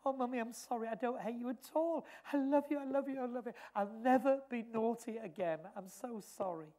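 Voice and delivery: put-on a little boy pleading sorry to mother; pitiful child's apology. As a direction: say sorry like a tearful small child. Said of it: "looked up so pitifully".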